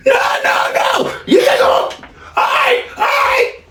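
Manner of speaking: very high, slow crying scream; son going through the roof. Retold or imitated. Son exploding at his father's remark, high crying scream. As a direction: shriek it high, sobbing with rage. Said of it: "son screaming as father leaves".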